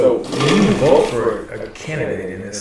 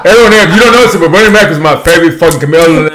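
loud, high, rapid comic rant; excited stand-up bluster; Bernie Mac; 5 semitones higher, 8 dB louder, faster than usual. Acted. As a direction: gush in a brash comedian's voice. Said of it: "You sounded just like Bernie Mac".

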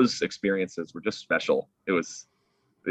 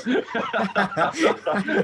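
bright giggle burst; high laugh. Real, not acted. Laughs after the quote.

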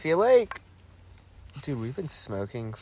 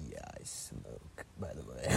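a casual whispered confession, slowed down; deadpan whisper; 10 semitones lower, 15 dB quieter than usual. Retold or imitated. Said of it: "just be like".

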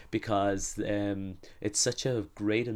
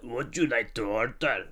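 mocking the accent. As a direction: parrot the question back mockingly. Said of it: "they just keep mocking your accent".

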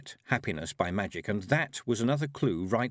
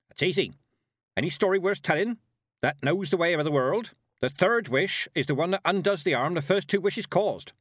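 put-on loud, high old witch voice; Granny Weatherwax; 9 semitones higher, 5 dB louder than usual. A narrator's reading. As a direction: pronounce it firmly as an old witch. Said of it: "said Granny".

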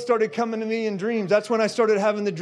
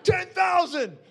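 shouted claim; 9 semitones higher, slower than usual. Retold or imitated. Retelling his bold shouted answer.

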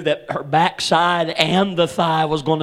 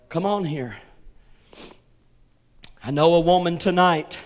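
ordinary delivery

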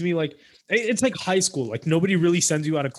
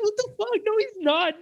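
squeaky outrage. Retold or imitated. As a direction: shriek it like you've been betrayed. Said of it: "I was like".

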